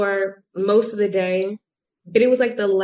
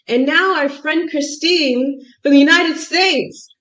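high, louder mock introduction; announcing priest; 7 semitones higher, 5 dB louder than usual. Priest introduces a visitor to the congregation, imitated announcement. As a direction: present a guest to the congregation, pitched up.

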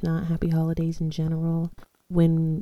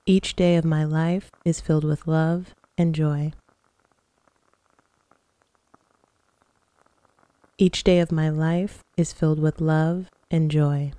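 guided meditation. Acted. Leads guided breathing and affirmations.

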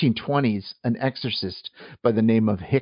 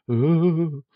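ghost voice; 5 semitones higher than usual. Shown as a demonstration. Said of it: "other than maybe".

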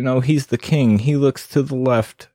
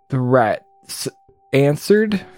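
usual speaking voice

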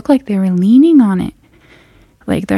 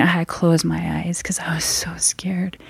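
scared whisper; 9 dB quieter than usual. Retold or imitated. Scared, eyes closed, hushed.

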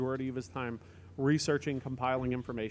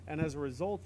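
usual speaking voice

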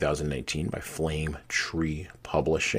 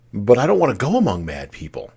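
higher, louder objection; protesting Alice; 6 semitones higher, 8 dB louder than usual. A narrator's reading. Alice objecting to the cat, protesting. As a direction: raise the voice in earnest protest.

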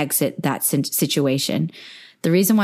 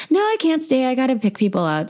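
high flustered refusal; 8 semitones higher than usual. Retold or imitated. Repeating her flustered refusal.

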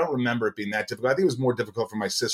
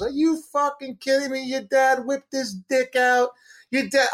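high, strained character voice, held on one note; old dentist impression; 11 semitones higher than usual. Dentist tells son about his dad, high put-on voice.

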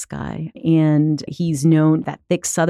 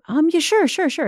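high-pitched, over-eager placating; apologetic agreeing; 12 semitones higher, 3 dB louder than usual. Retold or imitated. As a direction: agree at once so the moment ends. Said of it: "I just remember being super apologetic".

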